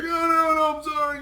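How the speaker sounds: high whimpering beg for mercy, hammed up; fake-sob an apology way up high; mock crying plea. Trudeau begging the Canadian not to hit him, mock crying. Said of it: "He's like".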